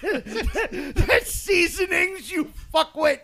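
high, slow words squeezed through laughter; laughing insult. Real, not acted. Correcting a friend's seasonings mix-up, helplessly laughing. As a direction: barely get it out for laughing.

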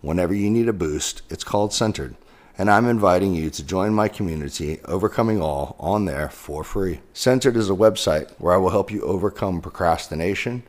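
loud, upbeat ad read. Acted. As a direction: pitch it like a sponsor spot. Sponsor ad read for Centered.

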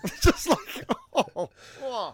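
laughing and swooning; laughing gush. Real, not acted. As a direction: giggle through it, voice going high. Gushing over emotional quote.

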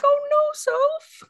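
high, drawn-out mock-sympathetic coo; pitying coo. Fan seeing photos of Sophie crying, high pitying coo. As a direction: stretch it out way up high.

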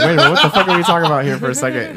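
fast, loud, laughing disbelief; shocked disbelief. Retold or imitated. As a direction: blurt it out in amused shock. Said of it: "I was like".

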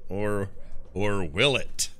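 mock announcer. Player signing off to everybody before a break, mock announcer voice. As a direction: sign off like a TV host, then drop into low mock mystery.

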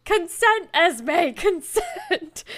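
high, insistent scolding with a laugh; mock outcry. Host scolds Esme for grabbing Winston, mock exasperated. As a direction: call it out high and emphatic, half laughing.

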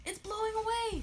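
very high storybook cry; urgent alarm. Acted. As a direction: cry out as it gets away. Alarm as sail flies off.

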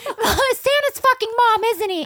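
put-on high, loud child begging the depressed dad for answers; whiny little kid. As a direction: whine like a heartbroken kid to dad.

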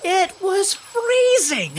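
plain reading voice

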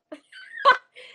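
bursting into high laughter; big laugh at her own joke; loud laugh. Real, not acted.

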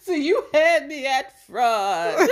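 slow, loud, high, laugh-choked; laughing sign-off. Real, not acted. Closing the podcast, laughing hard. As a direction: barely get it out through laughter.

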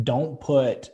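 usual speaking voice

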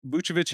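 Vucevic said VOO-cheh-vitch. Real, not acted. Trying the switched name mid-analysis, Vucevic with CH. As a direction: say Vucevic with a ch in the middle. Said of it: "see, I did that there".

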